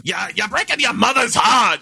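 loud, high-pitched New York outburst, r's dropped; exasperated Brooklyn parent yelling; Queens-Brooklyn accent. Acted. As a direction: holler it in thick Queens dialect. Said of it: "a very Queens, Brooklyn, Long Island thing".

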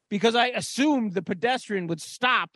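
ordinary talk, nothing marked

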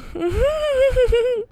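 squeaky high laugh. Real, not acted. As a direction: burst into a high giggle. Laughing at own insecurity.

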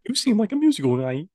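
high, wobbly attempt at frog puppet; badly faked puppet frog voice; Kermit the Frog. Friend copying the impression, attempted Kermit voice. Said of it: "Oh, I can't do it".

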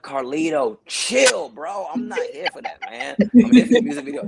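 retelling how he brushed off Carl, mock brush-off; mild brush-off. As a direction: wave him off, chill bro. Telling off Carl at shoot.

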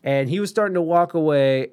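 normal talking voice

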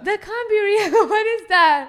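high, loud, amused shock; loud disbelief. Real, not acted. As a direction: exclaim it, reeling from laughter. Refusing to believe the picture.

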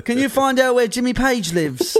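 high put-on rock-star voice. Continues the put-on rock star bit.

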